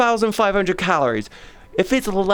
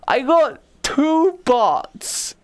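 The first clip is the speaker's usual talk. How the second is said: mock outrage; 9 semitones higher, much slower than usual. Real, not acted. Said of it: "bots replied to tweet".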